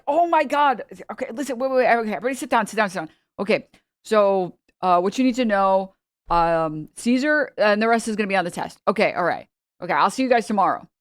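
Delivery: mock New York Italian teacher hurrying the class; lightly done New York Italian teacher rushing through the lesson; New York Italian accent. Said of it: "you know, New York Italian accent".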